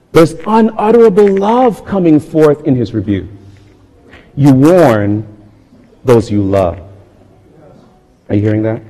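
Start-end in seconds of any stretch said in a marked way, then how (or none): none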